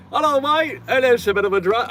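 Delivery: high-pitched, hammed-up British accent; mock British accent. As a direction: play a caricature British tourist greeting. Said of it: "walk in there with that accent".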